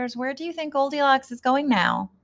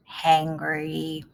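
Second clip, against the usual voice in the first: hangry said lower and softer; word hangry; 5 semitones lower, 4 dB quieter than usual. Shown as a demonstration. Teacher repeats new word to readers, lower. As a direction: drop down to a low hangry. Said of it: "Sometimes people call that".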